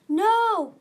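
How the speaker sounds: huge, high, drawn-out shout; roar. A narrator's reading. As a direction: roar it out in alarm at full volume. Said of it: "Marcia roared".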